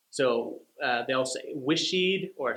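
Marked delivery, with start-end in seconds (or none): 1.5-2.3 "wishied" with an extra ee syllable after the sh; wrong extra syllable. Shown as a demonstration. Teacher copying the common learner mistake, higher pitch. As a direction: drag out "wishied" as wish-ee-d. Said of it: "They'll add an extra syllable at the end".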